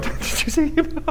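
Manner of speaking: high, hushed giggling gossip; breathless gossipy girl voice; giggly ladies. Retold or imitated. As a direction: squeal it quietly like a giddy friend. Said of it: "start looking at each other going".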